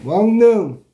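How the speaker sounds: 왕능 said loud and high with the nasal ㄴ clear; 왕능 loudly modelled as a spoken example; pronunciation demo. Shown as a demonstration. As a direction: pronounce 왕능 with a crisp ㄴ, not ㄹ. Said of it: "발음만 잘하면 돼".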